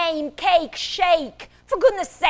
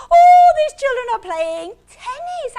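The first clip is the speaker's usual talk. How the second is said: high, loud excited opening; excited storytelling; 9 semitones higher, 10 dB louder than usual. Acted. Teacher showing children a tennis scene, excited. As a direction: burst into the picture with delight.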